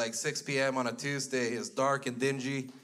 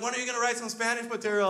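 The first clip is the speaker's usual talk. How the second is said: high, fast, voicing his aunt; aunt's question; 9 semitones higher, faster than usual. Aunt asking him at the show, high and fast. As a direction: pitch up high and quick for the aunt. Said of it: "She's like".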